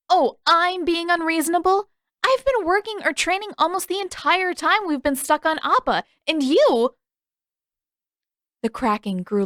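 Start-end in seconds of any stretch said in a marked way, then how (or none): 0.1-7.0 high, loud, rising indignation; furious outburst. Acted. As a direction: let the anger boil over. Katara blowing up at Sokka.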